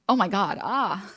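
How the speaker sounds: normal talking voice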